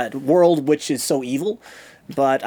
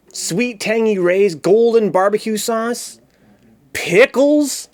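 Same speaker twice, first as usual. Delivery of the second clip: slow, savoured roll-call, rising in pitch; hyped topping reveal; 5 semitones higher, much slower than usual. Pretend. Streamer unveiling pizza toppings, slow hyped relish. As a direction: punch out each item with relish.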